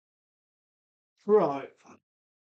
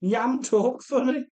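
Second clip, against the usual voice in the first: "Yam talk funny" in a Wolverhampton accent, higher and rising on "funny"; 4 semitones higher than usual. Shown as a demonstration. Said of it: "thick Wolverhampton accent".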